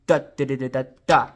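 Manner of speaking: da syllables with the first da hit harder; accent on the first downbeat. Shown as a demonstration. Teacher showing where the accent falls, punching the first Da. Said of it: "They're written on the first downbeat".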